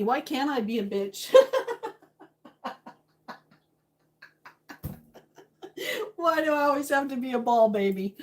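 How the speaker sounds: fast, laughing and crying at once; laughing through tears. Real, not acted.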